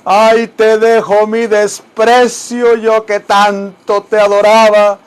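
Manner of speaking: loud, slow, dramatic mariachi-style declaiming; ranchera declamation. Acted. Teacher hamming up a ranchera for students. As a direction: belt it out like a cantina singer.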